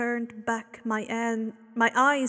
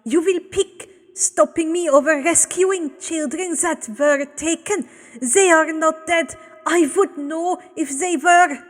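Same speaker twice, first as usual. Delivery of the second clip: raised, higher contemptuous scoff; 6 semitones higher, 8 dB louder than usual. Acted. Abel scoffs at the pair, raised and scornful. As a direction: sneer at their choice. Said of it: "Abel scoffed".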